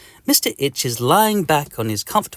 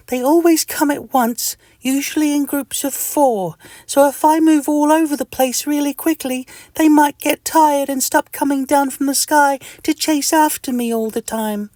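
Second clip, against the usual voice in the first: very high, louder little creature; Mister Itch; 4 dB louder than usual. Acted. Mister Itch working out his escape plan, high squeaky scheming.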